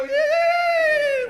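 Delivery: very high, loud wind noise; howling wind. Shown as a demonstration. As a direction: imitate the wind with a long high wail. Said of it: "everybody go".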